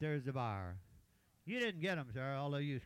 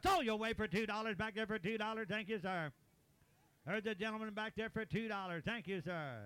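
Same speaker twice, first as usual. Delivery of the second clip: auctioneer; 4 semitones higher than usual. Real, not acted. Auctioneer calling bids.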